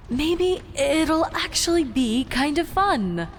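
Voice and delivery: a cheerful little voice in her head; happy carefree voice. Acted. Cheerful inner voice urging Celestia to cheer up, bubbly. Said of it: "in its happy, carefree voice".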